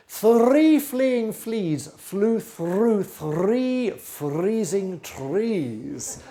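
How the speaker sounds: very slow, every F and TH drawn out; very slow tongue twister. Shown as a demonstration. Flute teacher closing with a final twister, very slow. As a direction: pull each word apart slowly. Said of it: "And finally today".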